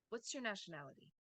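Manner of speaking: "nationality" falls steadily to the end; quick question dropping through NATIONALITY; WH-question intonation. Shown as a demonstration. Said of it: "or a W H question".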